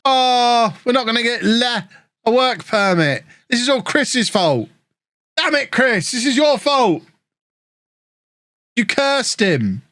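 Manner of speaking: high, playful mock outrage at Chris; pretend-furious, jokingly blaming a friend; mock blame. Blaming Chris for work permit.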